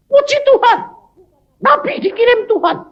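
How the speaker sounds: high, loud, elated cry of praise; excited praise. Retold or imitated. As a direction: hail the prophet with joy. Prisoner hails the preacher joyfully.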